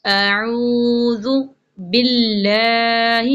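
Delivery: slow tajweed recitation of اعوذ باللہ; slow Quranic recitation. Shown as a demonstration. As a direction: recite slowly, drawing each sound out. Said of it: "یہاں پر نا لام باریک پڑھیں گے". (تجوید کے ساتھ آہستہ تلاوت)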